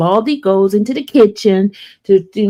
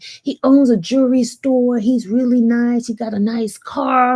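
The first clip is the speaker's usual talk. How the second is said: higher-pitched imitation of an eager sister; sister's matchmaking pitch; 5 semitones higher than usual. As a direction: talk him up as a pushy matchmaker. Sister talking up a suitor.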